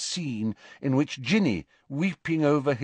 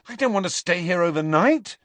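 angry; 8 semitones higher, 4 dB louder than usual. Acted. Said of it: "said Harry angrily".

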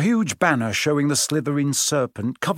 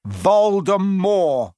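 loud, high, drawn-out defiant cry; bellow; 7 semitones higher, 5 dB louder, much slower than usual. A narrator's reading. Harry shocking weeping Hagrid with the name, bellowing. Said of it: "Harry bellowed".

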